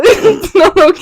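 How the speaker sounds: slow, high tearful wail with laughter; laughing to tears. Real, not acted. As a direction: protest through laughing sobs. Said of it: "I don't know why I'm crying".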